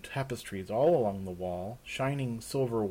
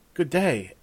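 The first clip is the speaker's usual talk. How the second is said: slightly raised, simple polite reply; guest returning a greeting; 5 semitones higher, 5 dB louder than usual. Acted. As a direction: answer the greeting in a modest voice. Raven returns the servant's greeting.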